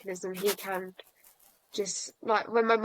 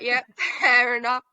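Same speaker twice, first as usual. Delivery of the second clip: a laugh breaking through crying; laughing through tears; 2 semitones higher, 6 dB louder than usual. Real, not acted. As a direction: laugh while still teary.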